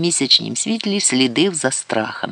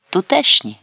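higher-pitched, brief reply; short answer; 7 semitones higher, 3 dB louder than usual. A narrator's reading. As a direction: give just the one word, briefly. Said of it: "відповіла вона коротко". (коротка відповідь вищим голосом)

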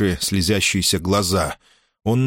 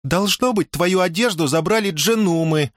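put-on high, teasing girl's voice; девичий голос; 10 semitones higher than usual. A narrator's reading. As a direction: pitch it up high, teasing him from afar. Дая поддразнивает спрятавшегося Мусу. (высокий дразнящий девичий голос)

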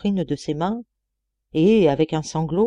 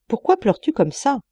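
higher, pressing question; insistent; 6 semitones higher, 3 dB louder than usual. A narrator's reading. Impatient roommate questioning the weeping deacon, insistent. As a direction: press him for an answer. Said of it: "répétait-il avec insistance".

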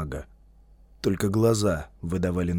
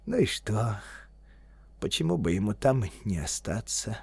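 softly reasoning with oneself; quiet self-persuasion; talking himself round; 7 dB quieter than usual. A narrator's reading. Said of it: "словно заговаривал сам себя".

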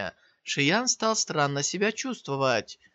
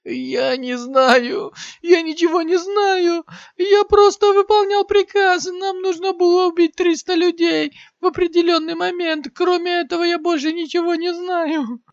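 panicked, crying shriek, much higher and louder; terrified screaming; 14 semitones higher, 8 dB louder than usual. A narrator's reading. Maimed warrior screams to Shiyan in terror. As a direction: beg for your life in panic. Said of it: "Со страхом в голосе закричал воин".

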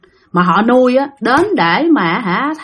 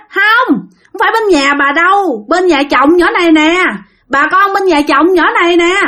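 spirit voice; 7 semitones higher than usual. Acted. Spirit answers through fortune teller.

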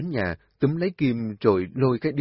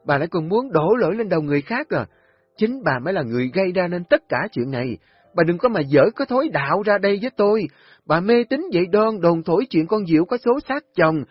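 high, loud, fast contemptuous rant; scornful tirade; 9 semitones higher, 6 dB louder, faster than usual. A narrator's reading. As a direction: lash out mockingly. Kim mắng bà Hoạt. (mắng nhiếc khinh bỉ)

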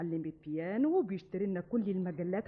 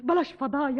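louder, insistent; heated quarrel; 3 semitones higher, 6 dB louder than usual. Acted. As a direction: push each line harder. Quarrel about running away. (إصرار بانفعال)

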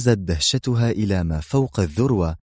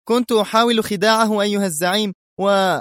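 high-pitched henchman justifying himself; henchman pleading excuse; 11 semitones higher than usual. A narrator's reading. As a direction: hurriedly explain yourself to the boss in a high voice. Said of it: "وأسرع إلى حيث يقف زعيمه قائلًا". (تابع يبرر لزعيمه بصوت عالي الطبقة)

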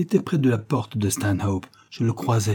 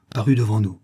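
ordinary delivery

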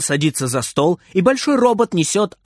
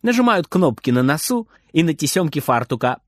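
ordinary delivery

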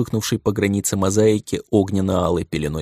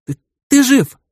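much higher and louder, joyful; overjoyed cry; 7 dB louder than usual. Acted. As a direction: cry out in disbelieving joy. Girl overjoyed he is alive. (радостный вскрик)

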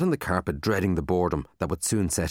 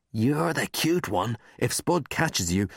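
slow, higher teasing coax; slow teasing Marty; 5 semitones higher, slower than usual. A narrator's reading. Marty pinches his friend's cheek, slow teasing coax. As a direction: coax him slowly like a sly big brother.

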